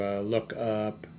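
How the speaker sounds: ordinary delivery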